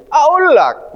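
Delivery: aulak with the qaf dropped to a glottal stop; Egyptian Arabic. Shown as a demonstration. Teacher imitates how Egyptians say aulak. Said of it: "Orang Misil".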